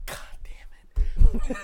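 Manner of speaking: slow, breathless whispered curse. Real, not acted. A fan reliving a shocking death, whispered curse.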